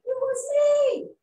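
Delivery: high, loud squeaky admission; squeaky confession. Acted. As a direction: blurt it out in the little squeak. Little warthog confessing.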